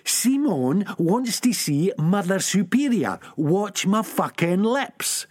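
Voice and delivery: धीरे, एक-एक शब्द ऊँचे सुर में; शब्द चबा-चबाकर बोलना; slow emphatic. Acted. Sick Boy slow emphasis.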